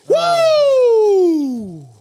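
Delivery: long, high, loud "woo"; loud whoop. Real, not acted. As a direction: let out a drawn-out high whoop.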